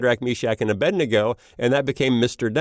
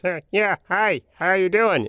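imitating the boss; 7 semitones higher than usual. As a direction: mimic the boss's funny way of talking. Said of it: "my imitation was".

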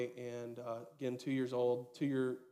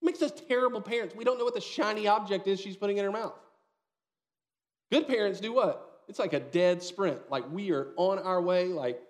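higher and louder, urgent punch; urgent excitement; 6 semitones higher, 5 dB louder than usual. Acted. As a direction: drive home the urgency of the sprint. Urgent story climax.

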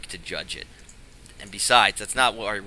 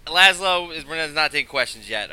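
loud; 6 semitones higher, 8 dB louder than usual. Acted. Wizard cuts off questioning.

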